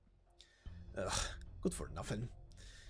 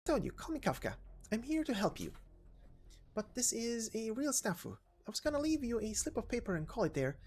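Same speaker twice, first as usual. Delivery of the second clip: put-on higher, lively young girl voice; perky girl; 11 semitones higher than usual. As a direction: play Kafka bright and cheeky. Kafka's voice.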